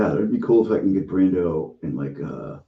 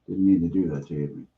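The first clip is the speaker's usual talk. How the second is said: normal talking voice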